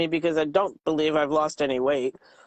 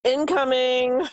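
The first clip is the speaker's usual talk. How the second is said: mock alarm call; 5 semitones higher than usual. Mock warning at passing helicopters.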